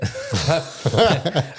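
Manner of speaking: high loud laugh at the memory; burst of laughter. Real, not acted. Laughing at own near-drowning.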